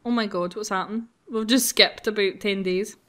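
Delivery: ordinary delivery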